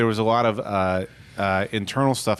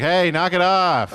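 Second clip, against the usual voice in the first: annoyed yell; 7 semitones higher, 4 dB louder, slower than usual. Acted. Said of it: "Jesus, like".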